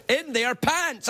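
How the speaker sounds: very slow, drawn-out punchline; hard-sold punchline. Retold or imitated. Host to audience, showing how hard he sold the punchline. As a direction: sell the words hard, slowly. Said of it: "I did the double hand out sell".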